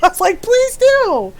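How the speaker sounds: high, sarcastic please do; a mother's mock-stern demand to her friend. Mother teasing best friend.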